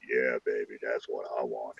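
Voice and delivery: low, hushed bedroom voice; very soft, deep mock seduction; mock lover. Acting the lover heard through wall.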